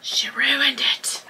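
whispered, slowed-down confession; mock-confiding whisper. Vlogger confessing she swatched the product, mock-confiding whisper. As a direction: drop to a slow whisper.

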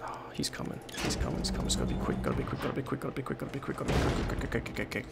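soft, hushed urgent whisper; tense whispered rush. Real, not acted. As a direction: hush it so he can't hear. Hiding from tyrant, whispering.

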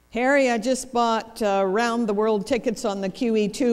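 loud, firm announcement to a spouse. Acted. Wife to inattentive husband at breakfast, loud projected announcement. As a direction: project it strongly to get his attention. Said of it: "I lowered my voice".

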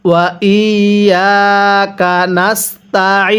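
slow, drawn-out ওয়া ইয়াকা নাস্তাইন; model recitation. Shown as a demonstration. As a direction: hold ইয়াকা and the final ee of নাস্তাইন. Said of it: "এরপরে পড়ুন".

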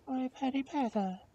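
high-pitched princess voice; tender princess. Acted. Princess cries out to her servant.

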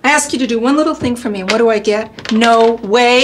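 wounded complaint building to a loud retort; hurt, then loudly indignant; aggrieved complaint. Acted. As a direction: build to throwing his refusal back at him. Mother throws refusal back at son.

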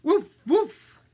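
two loud, high woofs; dog barking. Acted. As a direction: bark it like a storybook dog. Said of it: "And what do dogs say?".

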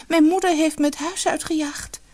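high, sustained sorrowful tone; plaintive. A narrator's reading. Outcast girl telling the prince her trouble, plaintive. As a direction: lament it in a small, high voice.

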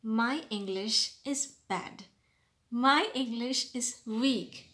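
wrong phrasing, slow. Shown as a demonstration. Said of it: "Don't ever say".

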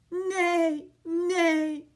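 loud, drawn-out neighs; horse neighing. Acted. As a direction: call out like a hungry horse. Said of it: "he calls to the farmer".

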